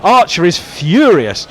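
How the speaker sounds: sudden high, loud burst of commentary; excited live call. Real, not acted. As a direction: jump up and call the appeal as it happens.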